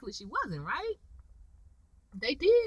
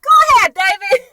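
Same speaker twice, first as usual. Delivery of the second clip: high, loud hype shout; cheering him on; 9 semitones higher, 9 dB louder than usual. Retold or imitated. Viewer rooting for David, gleeful cheering. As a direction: egg him on with glee.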